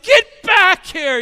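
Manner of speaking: high, very loud, drawn-out call; panicked yell. Retold or imitated. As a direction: holler after a runaway child. Dad yelling after painted child.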